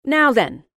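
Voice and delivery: businesslike. A narrator's reading. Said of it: "all businesslike".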